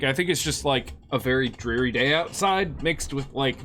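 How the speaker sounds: ordinary delivery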